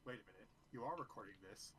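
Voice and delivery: usual speaking voice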